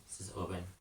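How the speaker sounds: plain reading voice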